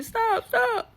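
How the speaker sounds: high mock scream of stop. Beaten friend screaming at the husband to stop, mock shriek. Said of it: "he's screaming".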